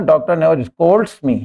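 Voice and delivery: very slow, each word separate; teacher modelling the sentence slowly; slow dictation. Shown as a demonstration. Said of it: "doctor is singular".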